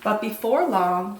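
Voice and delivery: plain reading voice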